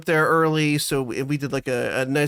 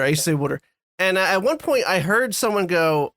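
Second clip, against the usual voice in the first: normal talking voice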